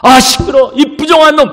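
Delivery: high, loud angry scolding; yelling abuse. Acted. Crowd rebuking the blind beggar, yelling. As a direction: shout the beggar down. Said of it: "고함을 치기 시작합니다".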